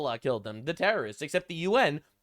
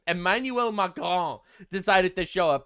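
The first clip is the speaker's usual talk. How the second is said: usual speaking voice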